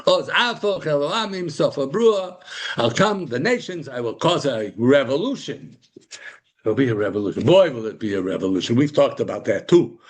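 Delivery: usual speaking voice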